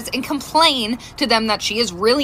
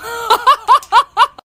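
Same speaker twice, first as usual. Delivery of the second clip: high, loud cackle; laughing; 10 semitones higher, 6 dB louder than usual. Real, not acted. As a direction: burst of staccato laughter. Laughs at own punchline.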